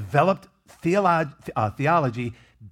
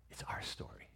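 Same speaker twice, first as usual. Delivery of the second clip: breathy hushed whisper on the point; 22 dB quieter than usual. Real, not acted. Pastor whispering it to the congregation. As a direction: drop to a secret whisper.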